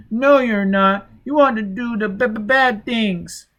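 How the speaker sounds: put-on high, slow crying child voice; frightened child. A narrator's reading. Shriveled child crying at the intruder, high and slow. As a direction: whimper it like a scared little boy. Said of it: "The child began to cry".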